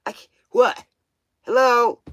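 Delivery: ordinary talk, nothing marked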